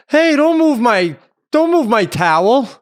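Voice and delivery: loud, high-pitched mock holler; calling out angrily. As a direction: imitate a stranger yelling across the beach. Irate beachgoer calling out from afar.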